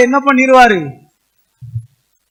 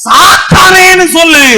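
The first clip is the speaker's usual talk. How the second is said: slow, higher, loud rebuke of Satan; commanding shout; 4 semitones higher, 7 dB louder than usual. Shown as a demonstration. Preacher showing the church how to rebuke Satan, loud. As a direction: bark it out to drive the devil away. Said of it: "ஒன்னே ஒண்ணு சொல்லு".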